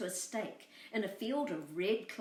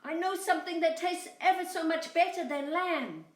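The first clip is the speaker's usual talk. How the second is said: high, loud bleating lamb voice; 10 semitones higher, 6 dB louder than usual. Acted. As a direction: bleat it high, tempting him. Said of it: "Boragita bleated".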